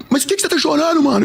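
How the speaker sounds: high, imitated sobbing question; tearful. Weeping clerk asking why he cries, tearful. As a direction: whine it high as if crying. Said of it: "os dois chorando".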